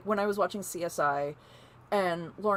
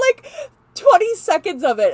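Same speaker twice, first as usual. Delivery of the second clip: ordinary delivery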